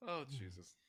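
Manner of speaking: ordinary delivery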